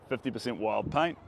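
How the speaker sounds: ordinary talk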